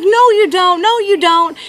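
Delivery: put-on high, loud kid contradiction; imitated boy's loud protest; arguing boy. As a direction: fire back like an indignant child. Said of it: "He's like".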